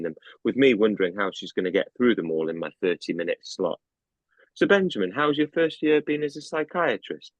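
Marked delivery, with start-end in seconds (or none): 4.5-7.3 brightly voiced older doctor; a lightly voiced senior doctor asking; higher supervisor voice. A narrator's reading. Dr Patel's question voiced.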